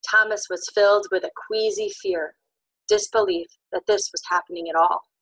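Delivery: ordinary delivery, nothing marked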